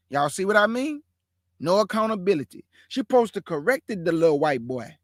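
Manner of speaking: ordinary talk, nothing marked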